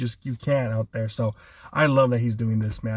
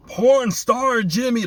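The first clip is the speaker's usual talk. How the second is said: much higher, louder, slow drawn-out jeer; Stephen A Smith impression; 11 semitones higher, 4 dB louder, much slower than usual. Pundit mocking the quarterback, high, loud and slow. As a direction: belt it out like a TV pundit. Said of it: "then you heard Stephen A Smith".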